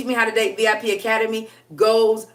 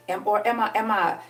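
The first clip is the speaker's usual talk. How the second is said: ordinary talk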